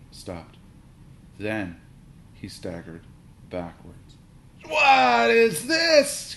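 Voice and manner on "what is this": very high, loud, slow wail. A narrator's reading. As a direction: wail in utter despair. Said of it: "he wailed".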